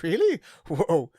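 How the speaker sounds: high, rising mock astonishment; a playful "really?" of amazement; lightly acted shock; retold surprise. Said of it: "I was like".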